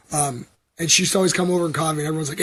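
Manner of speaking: ordinary talk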